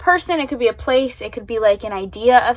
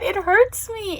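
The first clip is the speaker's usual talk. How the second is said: pained; 10 semitones higher, slower than usual. Real, not acted. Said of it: "I can't form words".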